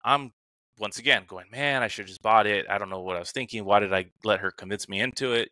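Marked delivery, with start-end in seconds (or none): none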